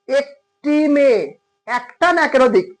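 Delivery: drawn-out teacher question; slow quizzing. Shown as a demonstration. Teacher quizzing students on singular or plural, slowly. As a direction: stretch it out so they think.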